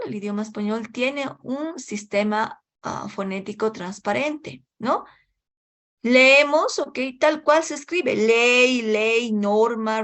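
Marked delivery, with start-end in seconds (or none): none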